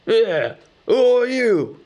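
a mild squeaky purse voice; squeaking purse. Acted. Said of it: "it squeaked".

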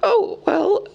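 high, awkward hobbit hedging; uncomfortable. A narrator's reading. Bilbo asked about ownership, uncomfortable. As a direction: squirm out a high-pitched reply. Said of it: "said the hobbit uncomfortably".

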